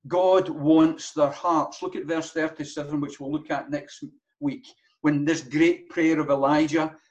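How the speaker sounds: ordinary talk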